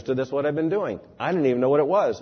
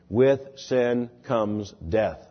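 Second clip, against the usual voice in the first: slow scripture quote; 2 semitones lower, much slower than usual. Shown as a demonstration. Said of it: "says the Bible".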